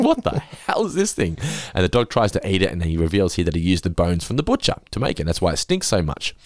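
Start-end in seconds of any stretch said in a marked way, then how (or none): 0.0-1.4 laughing disbelief. Retold or imitated. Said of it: "I was like".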